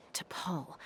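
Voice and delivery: whispered to himself; a private whispered musing. Acted. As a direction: breathe the name, no voice. Name repeated in a whisper.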